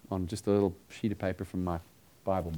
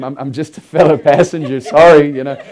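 loud, higher, laughing apology; laughing reply; 6 semitones higher, 18 dB louder than usual. Retold or imitated. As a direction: play innocent while cracking up. Acts out his sheepish reply, laughing.

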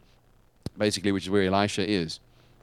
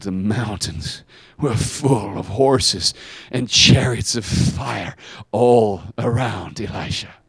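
slow reveal; 4 dB louder, slower than usual. Acted. Dramatic reveal of fiery army.

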